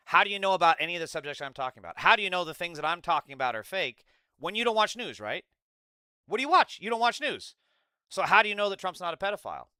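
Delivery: fast, loud, furious questions; angry shouting. Real, not acted. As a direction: hammer him with questions. Heated argument, yelling questions.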